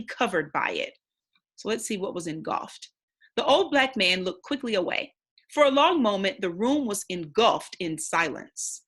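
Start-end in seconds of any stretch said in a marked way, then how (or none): 3.4-8.8 steady, expressive read-aloud narration; story narration. Acted. Teacher reading the novel to the class, storytelling narration. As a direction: read the chapter aloud to young listeners. Rereading the passage.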